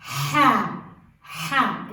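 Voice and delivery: Ha with a breathy throat ḥ; Ha, ha pushed from the throat; Arabic ḥa. Shown as a demonstration. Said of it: "this ha is when you clear your throat".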